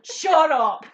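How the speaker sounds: very loud comic shut-up; exasperated shout. Retold or imitated. Woman silencing boyfriend during her letter, exasperated shout. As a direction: shout him down, half laughing.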